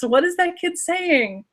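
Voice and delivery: ordinary talk, nothing marked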